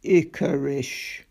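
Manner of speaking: put-on a huge old beast answering slowly; deep, unhurried Ikabog voice; slow deep monster voice. A narrator's reading. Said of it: "in its slow, deep voice".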